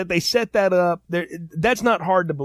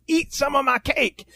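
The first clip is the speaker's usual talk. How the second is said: insistent host; 10 semitones higher than usual. Retold or imitated. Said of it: "this guy's insistent".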